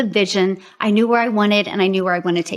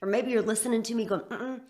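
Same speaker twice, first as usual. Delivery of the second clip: smooth promotional webinar delivery, a little softer; sales pitch read; 9 dB quieter than usual. Acted. Coach pitching her program to prospective buyers, promo read.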